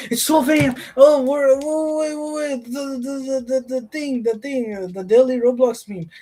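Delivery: high, sudden flustered excitement; excited. Real, not acted. Streamer realizing it's midnight and the daily meme is out, excited and high. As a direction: rush to catch it before it's gone.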